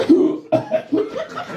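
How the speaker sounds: hiccupy cough in sleep; imitated choking cough. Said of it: "a cough or some shit".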